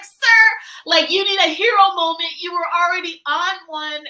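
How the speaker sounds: high, loud mock scolding; scolding fan. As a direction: scold the hero loudly. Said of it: "I'm like".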